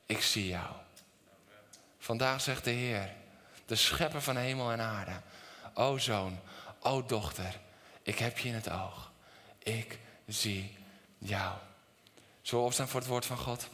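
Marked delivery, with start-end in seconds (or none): 0.1-11.6 low, soft, tender voice of God. Acted. God tells each son and daughter he sees them, soft reassurance. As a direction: speak gently as God reassuring his child. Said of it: "zegt God tegen jou".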